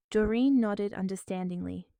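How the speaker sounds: plain reading voice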